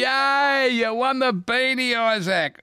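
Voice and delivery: high-pitched congratulations, settling at the end; excited game host cheering; delighted cheer. Real, not acted. Host celebrates caller's win.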